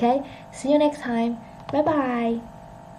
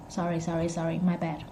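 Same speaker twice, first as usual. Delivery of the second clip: low, much softer sorry; quiet apology; 5 semitones lower, 10 dB quieter, faster than usual. Real, not acted. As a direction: apologise under your breath. Muttered apology for a mishap.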